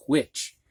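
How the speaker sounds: "which" opened with a long, audible puff of breath before the W; exaggerated breathy H. Shown as a demonstration. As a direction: overdo the H in "which", blowing air into it as "hwich". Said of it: "and I'm exaggerating".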